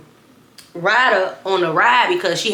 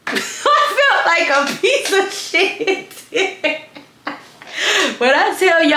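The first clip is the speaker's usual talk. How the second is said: laughing through it; 3 semitones higher, slower than usual. Real, not acted. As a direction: laugh while admitting it. Laughing at own shame.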